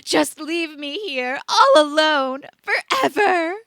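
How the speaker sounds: loud, high sobbing wail, slow; crying wail. A narrator's reading. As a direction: sob it out, abandoned and dramatic. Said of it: "she wailed".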